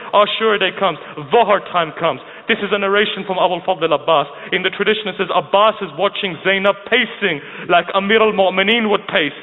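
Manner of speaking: ordinary delivery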